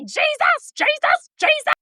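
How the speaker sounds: high frantic cries of Jesus; screaming Jesus. Retold or imitated. Pinned by the devil, screams for Jesus high. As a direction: cry out to Jesus in a panic, high. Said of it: "I screamed Jesus three times".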